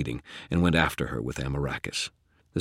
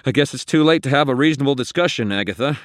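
quick, raised voice over danger; calling out; 10 semitones higher, 7 dB louder, faster than usual. Acted. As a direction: call it out high and loud to the enemy. Wizard calls out to raging ghost.

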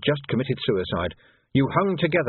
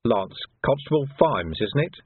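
ordinary delivery

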